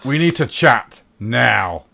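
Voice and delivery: loud, slow, firm order; forceful, no-nonsense summons; stern demand. Acted. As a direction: demand it on the spot, spacing out the words. Reese confronts Henry after shouting.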